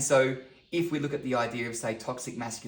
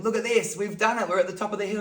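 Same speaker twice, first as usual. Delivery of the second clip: high, loud and triumphant; acted-out fatherly celebration; proud cheering dad; 9 semitones higher, 7 dB louder than usual. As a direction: cheer as if you both just summited. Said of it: "so your dad".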